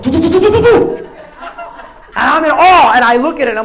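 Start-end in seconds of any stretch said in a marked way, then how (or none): 0.0-1.0 high rising doo doo rattle; table rattling noise. Retold or imitated. Comedian voicing a table rattling under heavy dildos. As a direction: sing out the shaking table in rising doos. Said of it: "the table's like".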